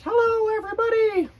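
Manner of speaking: squeaky high-pitched puppet voice, loud and bright; high Grover voice. Acted. Reading to kids, Grover greets everybody in a squeaky high voice. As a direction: pitch way up into a furry monster's hello. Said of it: "It says".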